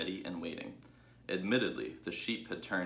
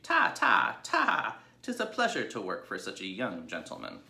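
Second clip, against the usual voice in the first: higher, louder doting ewes; fond sheep crying out; 3 semitones higher, 6 dB louder than usual. Acted. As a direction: coo over the little pig.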